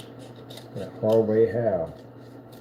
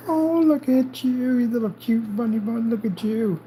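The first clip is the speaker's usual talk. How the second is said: sympathetic aww; 12 semitones higher than usual. Real, not acted. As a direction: croon to the little bunny. Cooing to the cat.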